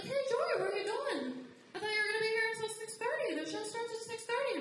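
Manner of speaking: high, singsong coworker voice; coworkers' high voice. Retold or imitated. Coworkers asking why she leaves, high and singsong. Coworkers questioning her leaving.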